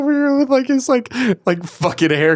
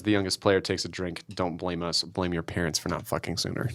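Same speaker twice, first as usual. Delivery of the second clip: quiet card read; 11 semitones lower, 12 dB quieter than usual. Real, not acted. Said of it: "reading the card".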